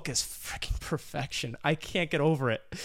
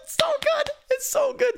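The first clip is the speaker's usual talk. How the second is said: much higher, loud fangirl squeal; ecstatic gushing; 4 dB louder than usual. Real, not acted. Host gushes to co-host about the fight scene, squealing. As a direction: squeal with pure delight.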